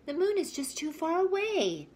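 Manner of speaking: a high little bird voice; lightly high bird voice. Acted. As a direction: chirp it like a small bird.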